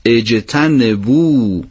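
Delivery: اجتنبو spelled out very slowly; slow recitation. Shown as a demonstration. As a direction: draw out each syllable of اجتنبو. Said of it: "باید اضافه بفرمایید". (اجتنبو خیلی آهسته و شمرده)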